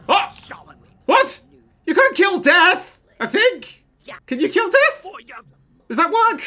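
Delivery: high, loud, incredulous questions; can't believe what you're seeing; stunned disbelief. Real, not acted. Sim attacks Death.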